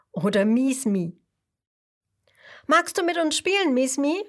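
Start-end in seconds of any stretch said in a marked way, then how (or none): 2.7-4.2 bright, higher little fish voices inviting to play; fish swarm asking. Acted. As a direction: call out like a friendly school of fish. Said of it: "fragt ein Schwarm Fische".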